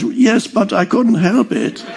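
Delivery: self-justifying inner voice. Acted. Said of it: "you have another thought and says".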